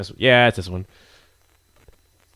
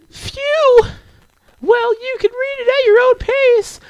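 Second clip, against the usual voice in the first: put-on very high-pitched anime girl; high, girlish and pleased; relieved shy girl; 4 dB louder than usual. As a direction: let relief into a falsetto character voice. Voices a relieved game girl.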